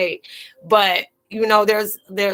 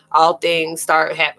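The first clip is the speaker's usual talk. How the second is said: normal talking voice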